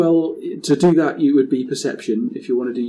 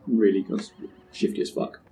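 ordinary talk

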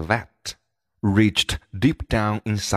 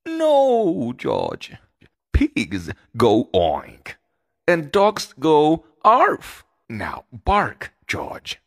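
patient mother, slower and firmer; 6 semitones higher, 4 dB louder, slower than usual. Acted. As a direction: spell it out slowly for the pup. Mother corrects George again.

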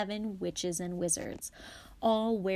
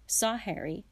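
ordinary delivery

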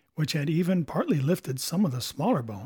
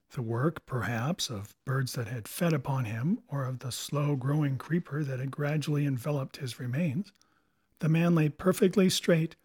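ordinary delivery, nothing marked

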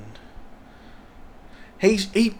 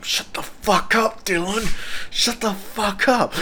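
urgent friend; 9 semitones higher than usual. Retold or imitated. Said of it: "Lockie was just like the whole time".

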